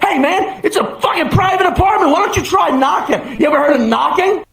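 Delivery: high, indignant protest; mock-outraged comeback; defensive. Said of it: "now I'm defensive".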